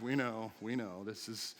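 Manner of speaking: a mild imitation of the bored, exasperated family; eye-rolling family. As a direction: sigh it like an old familiar complaint. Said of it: "they just roll their eyes".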